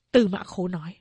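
usual speaking voice

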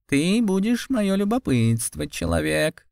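high snorting cat spirit voice. Acted. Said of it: "фыркнул кот".

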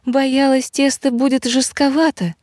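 high-pitched, modest wifely voice; bashful wife. A narrator's reading. Blushing wife answering her husband's compliment, bashful.

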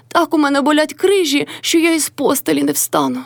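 high, pitiful imitation of an aching woman; mock plaintive moaning. A narrator's reading. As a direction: whine about pain, mimicking the mother-in-law. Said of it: "таким жалібним голосом".

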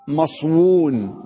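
مصون drawn out slowly with a full و, maswun; slow teacher reading of مصون; slow rare form. Shown as a demonstration. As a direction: stretch مصون out to show the kept و. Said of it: "ما الأصل أن نقول".

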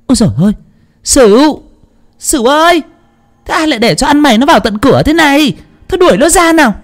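very high-pitched mistress yelling for a servant; scolding holler. Acted. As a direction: order the intruder thrown out, voice pitched sky-high. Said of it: "bà bịt mũi rồi quát". (giọng bà chủ quát tháo the thé)

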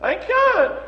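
high, pleading whine of refusal; sick man refusing. Retold or imitated. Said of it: "He said".

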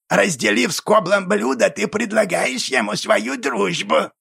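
creaky goblin voice. A narrator's reading. Goblin explains custom.

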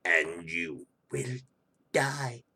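low, soft menacing villain croon; a creepy low evil-emperor threat; sinister villain voice. Pretend.

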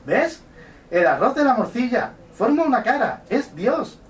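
high, loud falsetto woman voice; man doing a woman's voice. Acted. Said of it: "voy a poner voz, mm, de mujer".

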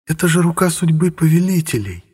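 high, drawn-out demoness voice; thoughtful drawl. A narrator's reading. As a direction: draw the words out, lost in thought. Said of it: "задумчиво протянула Элулу".

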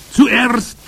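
high, loud witch voice; commanding Grand High Witch. A narrator's reading. Witch leader starting to explain her recipe, commanding.

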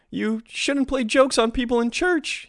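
put-on high, slow child's scolding; a little boy telling off a prankster; a boy scolding the voice behind the door; chiding boy. A narrator's reading. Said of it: "he chided".

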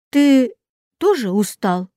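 ordinary delivery, nothing marked

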